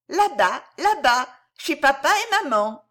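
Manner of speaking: put-on high, small childlike voice, comforting; a toddler soothing a crying friend; little girl voice. Little Violette comforting the weeping bear boy, high childlike voice.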